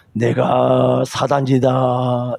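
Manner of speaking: low, very slow, hammed-up devil announcing himself; lightly voiced mock villain; mock Satan voice. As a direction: drag it out like a cartoon devil.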